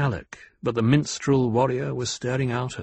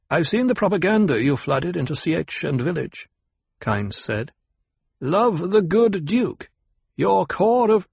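high, mocking character voice, slowing on the slogan; Kynes, scornful planetologist; 6 semitones higher than usual. A narrator's reading. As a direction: throw the slogan back with scorn.

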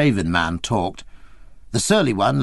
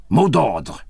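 curt, slightly louder challenge at the door; sharp demand; 3 dB louder than usual. A narrator's reading. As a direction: snap the password question at him. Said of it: "he said sharply".

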